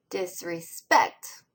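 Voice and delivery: "Disrespect" slowly, strongest on the last syllable; slow dis-re-SPECT for "Disrespect"; stress on SPECT. Shown as a demonstration. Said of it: "Spect gets the strongest stress".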